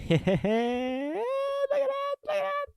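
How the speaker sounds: very high, slow squeal; delighted squeal. Real, not acted. Fan squealing over the new signing's photo.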